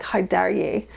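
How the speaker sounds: ordinary talk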